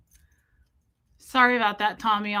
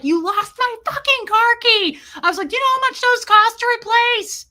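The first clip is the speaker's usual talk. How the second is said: high, loud mock rant; angry accusation; 12 semitones higher, 8 dB louder than usual. Retelling her rant at Tommy over the key, loud and high. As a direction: rant at him in outrage.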